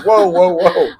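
loud, high, drawn-out whoas; astonished whoa. Retold or imitated. Vice President Gore cutting him off, loud and astonished. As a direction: stop him short in amazement. Retelling Gore's surprised reaction.

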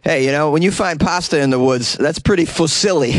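put-on New Jersey joker; Jersey accent. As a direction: land the pun in a Jersey accent. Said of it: "With a Jersey, Jersey accent".

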